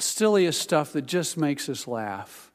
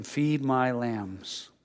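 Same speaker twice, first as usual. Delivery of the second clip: slow command; 4 dB quieter, much slower than usual. Retold or imitated. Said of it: "he said to him".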